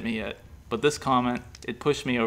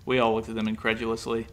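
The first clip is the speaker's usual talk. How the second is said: ordinary delivery, nothing marked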